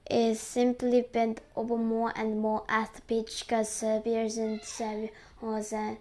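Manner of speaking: plain reading voice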